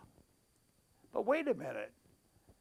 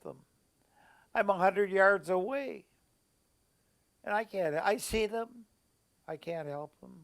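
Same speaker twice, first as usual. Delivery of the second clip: emotional. Real, not acted. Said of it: "I get emotional when I talk about it".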